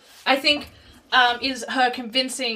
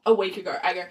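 ordinary talk, nothing marked

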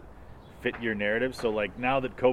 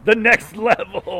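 excited shout; 12 semitones higher, 11 dB louder, slower than usual. Real, not acted. Friend echoes excitedly.

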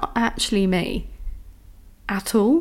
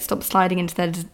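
usual speaking voice

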